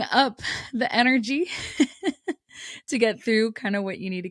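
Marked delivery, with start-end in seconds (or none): 1.5-2.3 short high laugh. Real, not acted. Laughing about mustering energy.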